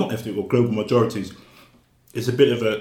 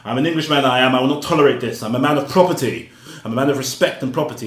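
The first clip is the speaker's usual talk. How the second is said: higher and louder, a haughty posh Englishman; pompous English gentleman; 4 semitones higher, 8 dB louder than usual. Retold or imitated. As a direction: puff yourself up like an indignant English officer. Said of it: "this English guy comes along talking about".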